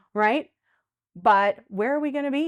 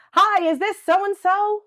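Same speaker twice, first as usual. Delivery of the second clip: telemarketer; 10 semitones higher, 8 dB louder, slower than usual. Retold or imitated. Imitating a telemarketer.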